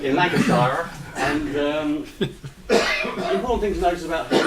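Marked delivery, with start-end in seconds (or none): 0.2-0.9 "Lancashire" with a full "shy-er" ending, amused; "Lancashire" as Lanca-SHY-er, through amusement; Dennis's pronunciation. Retold or imitated. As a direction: say "Lancashire" so the end rhymes with fire. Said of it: "as Dennis would say".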